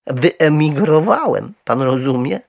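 high, loud explanation; earnest little bear. A narrator's reading. Little bear explaining himself to Mr Brown, earnest.

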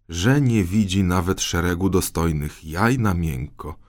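ordinary delivery

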